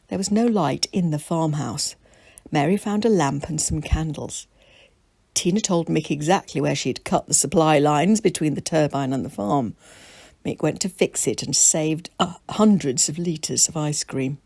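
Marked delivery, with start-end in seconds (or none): none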